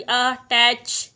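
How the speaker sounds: अटैच said very slowly, its first आ stretched long; drawn-out vowel demo. Shown as a demonstration. Teacher models अटैच for shorthand students, slow and stretched. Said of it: "आ की sound आ रही".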